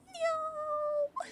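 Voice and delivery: high mock wail; mock horrified cry. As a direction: cry out in fake horror. Mock horror reaction.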